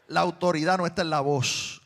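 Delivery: loud, driving preacher's declaration; full-force preaching. Acted. Pastor declaring to his congregation where authority comes from, full-force preaching.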